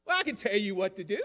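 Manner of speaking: hammed-up smug comeback; mock haughty reply. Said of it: "the reply might be".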